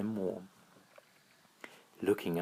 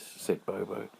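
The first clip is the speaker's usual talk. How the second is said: usual speaking voice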